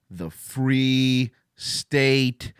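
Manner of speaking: "The free state of Florida" drawn out very slowly and grandly; slow proud proclamation. Shown as a demonstration. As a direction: proclaim it slowly, savouring each word. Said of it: "the free state of Florida".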